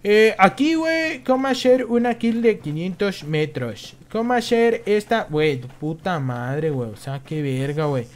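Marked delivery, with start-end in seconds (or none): 0.0-5.3 higher, louder mocking voice of an eager kid gamer. Streamer mocking kid players who ask about long kills, higher and louder. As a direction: parody the know-it-all kid asking how to snipe. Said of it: "el pinche niño ratas, güey, empiezan".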